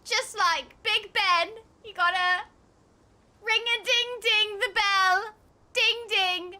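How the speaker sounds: mock British accent. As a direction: plug the bell as a pretend Brit. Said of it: "us British people say".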